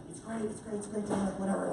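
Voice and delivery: very soft, hurried brush-off; dismissive mumble. Retold or imitated. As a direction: get the small talk over under your breath. Brushing off chatty ticket man.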